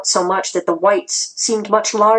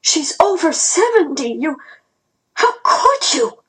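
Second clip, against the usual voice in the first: very high-pitched, indignant outburst; outraged shout; 9 semitones higher than usual. A narrator's reading. Mom shielding Grandma from Thin Face, shouting. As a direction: protest in shocked outrage, voice leaping up. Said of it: "Mom shouted back at Thin Face".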